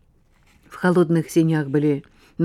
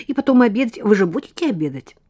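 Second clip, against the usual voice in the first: rattling off; 5 semitones higher, 6 dB louder than usual. Acted. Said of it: "затараторила Лена".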